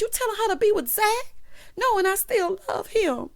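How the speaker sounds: high-pitched acted protest; mock indignation. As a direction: imitate an outraged woman up high. Acted indignant Karen quote.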